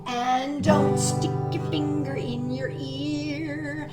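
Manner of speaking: ordinary delivery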